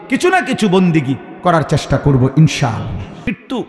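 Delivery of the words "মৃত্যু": ordinary talk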